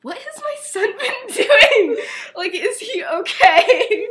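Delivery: high, loud, slow words broken by laughter; laughing mock alarm; laughing worried dad. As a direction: play a baffled father, cracking up. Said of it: "and he's like".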